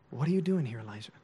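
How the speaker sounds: soft, low, tender question; gentle God. Acted. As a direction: ask gently, dropping away on the name. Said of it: "What do you think his voice sounded like?".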